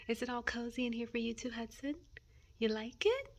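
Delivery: high, sing-song cooing to a pet. Real, not acted. Owner coos to her dog Hudson, high baby talk.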